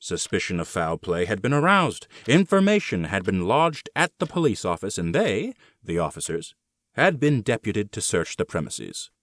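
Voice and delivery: plain reading voice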